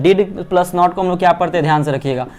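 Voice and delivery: ordinary talk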